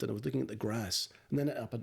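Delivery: ordinary talk, nothing marked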